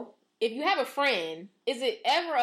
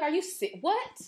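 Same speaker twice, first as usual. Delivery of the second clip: shocked; 7 semitones higher, slower than usual. Retold or imitated. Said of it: "I'd be like".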